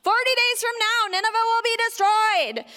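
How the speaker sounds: loud, high prophet's warning; shouted proclamation. A narrator's reading. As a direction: call it out to the crowds. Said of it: "he shouted to the crowds".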